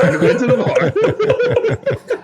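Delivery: hammed-up teasing repeat of the line; high sing-song repeat. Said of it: "hot chocolate's like".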